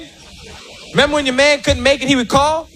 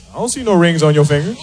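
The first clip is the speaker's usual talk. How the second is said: low cold man; 6 semitones lower, faster than usual. Retold or imitated. Man's cold reply.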